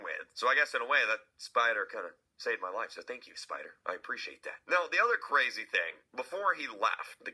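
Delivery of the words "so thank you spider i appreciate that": much softer, low mock-grateful thanks. Real, not acted. The speaker thanking the spider that saved him, soft and grateful. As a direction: murmur a quiet thank-you to the spider.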